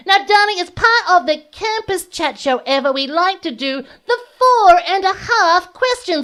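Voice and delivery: high, grand diva host; campy drag queen. Acted. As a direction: announce it like a fabulous drag host.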